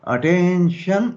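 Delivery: high, long-drawn attention call; drill command. Shown as a demonstration. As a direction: stretch the command out, rising at the end.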